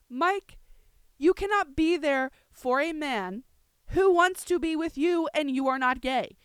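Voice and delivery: indignant telling-off that ends loud; aggressive rant. Real, not acted. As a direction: lecture them in exasperation, building to a yell. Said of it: "I did sound very aggressive".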